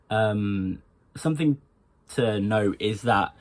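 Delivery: normal talking voice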